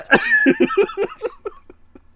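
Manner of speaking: high loud burst of laughter; big laugh. Real, not acted. Friend laughing at her own disgust. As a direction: laugh hard.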